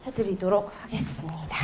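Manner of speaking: ordinary delivery, nothing marked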